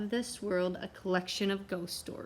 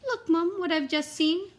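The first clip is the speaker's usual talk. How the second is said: put-on higher excited child's call; excited little girl; 9 semitones higher, 6 dB louder than usual. The girl shows her mum the saucer, high excited child. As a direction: tug at mum, eager. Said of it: "She tugged her mother's sleeve and said".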